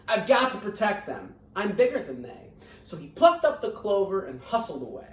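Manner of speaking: lively, sing-song storybook reading; reading to children. A narrator's reading. Teacher reading Horton Hears a Who to her scholars, lively storybook voice. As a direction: read it out warmly to a class of kids.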